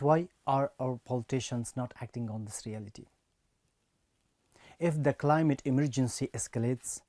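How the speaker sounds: normal talking voice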